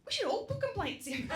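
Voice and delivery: high, loud, eager proposal; hammed-up relative rallying everyone at the top of the voice; excited rallying. Mother-in-law urging the family to complain, excited.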